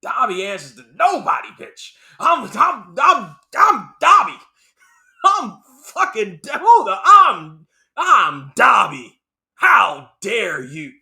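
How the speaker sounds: loud, slow defiant elf shouting back; defiant Dobby. Retold or imitated. Dobby defying Bellatrix, a loud slow furious shout. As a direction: stand tall and yell who you are. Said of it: "And Dobby's like".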